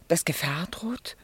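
whispered, worried question; hushed fear rising at the end; fearful whisper. Acted. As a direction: breathe it out, afraid to ask. Anxious question about danger.

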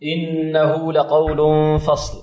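slow melodic tajweed chant; Quran recitation. Acted. Teacher chants the verse for students, slow and melodic. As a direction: recite slowly, drawing out each vowel.

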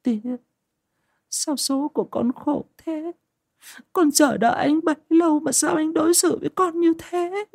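very high-pitched plaintive wail; tearful daughter. A narrator's reading. Daughter asking father why fate is cruel, wailing. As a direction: lament in a thin high voice, drawing out the words.

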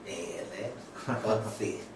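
ordinary delivery

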